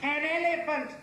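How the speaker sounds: high-pitched, insistent victim voice. Acted.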